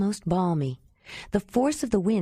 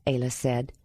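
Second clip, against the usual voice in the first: plain reading voice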